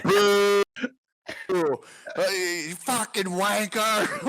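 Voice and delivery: high, slow, drawn-out crowd jeer; jeering heckler. Acted. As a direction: play the booing crowd.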